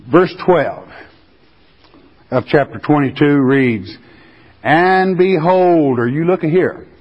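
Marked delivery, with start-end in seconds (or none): 4.6-6.0 slow, solemn Bible reading; scripture reading. Acted. Preacher reading Revelation to congregation, solemn proclaiming. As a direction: proclaim the verse slowly.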